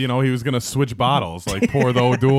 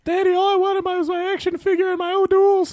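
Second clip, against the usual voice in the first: put-on very high, loud squeaky child wail; mock crying kid; 5 dB louder than usual. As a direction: bawl like a hammed-up heartbroken kid.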